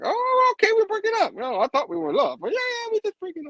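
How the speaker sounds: mock him in a high whiny voice. Imitating Peter taking the breakup lightly, high mock voice.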